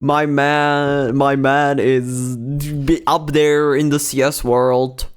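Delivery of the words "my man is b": usual speaking voice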